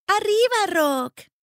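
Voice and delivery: high, loud urging; encouraging. A narrator's reading. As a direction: cheer a bird on to rise. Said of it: "dijo Annie alentándolo".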